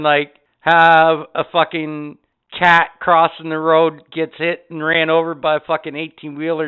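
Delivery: usual speaking voice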